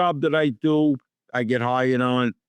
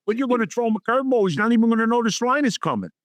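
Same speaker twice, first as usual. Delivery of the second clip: fast urging; 9 semitones higher, much faster than usual. Retold or imitated.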